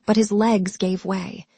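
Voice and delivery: plain reading voice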